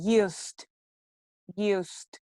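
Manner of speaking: "Used" as one syllable ending in t; correct pronunciation. Shown as a demonstration. Teacher drilling the past form, correct pronunciation. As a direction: say "Used" as yoost, pausing between takes. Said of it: "It's like a T at the end".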